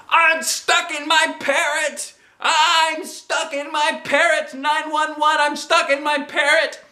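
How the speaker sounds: very high, slow-stretched screaming; panicked shouting. A narrator's reading. Girl stuck in swim ring, panicked shouting. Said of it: "I panicked and shouted".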